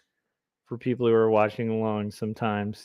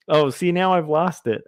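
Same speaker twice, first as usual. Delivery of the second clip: usual speaking voice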